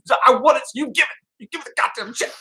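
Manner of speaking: high-pitched horror-movie character quote; Chris Kattan. Retold or imitated. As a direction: squeal it out like a comic movie panic. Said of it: "Chris Kattan in a horror movie".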